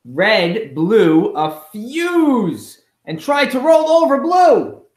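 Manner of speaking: building story excitement. A narrator's reading. Teacher reads Red charging at Blue, building excitement. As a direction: ramp up the drama as Red charges. Narrating Red's rage in the book.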